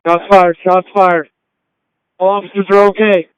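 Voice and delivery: loud, urgent officer call; urgent radio shout. Real, not acted. Officer reports shooting on radio.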